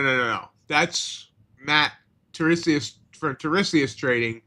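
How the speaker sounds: normal talking voice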